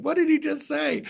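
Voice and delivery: high mock confusion from a lost listener; confused viewer. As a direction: play a baffled viewer, voice jumping way up. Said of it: "You're like".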